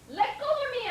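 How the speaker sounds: high, loud shout. Acted. Dog shouting at the man to let go. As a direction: yell to be let go. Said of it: "I shouted".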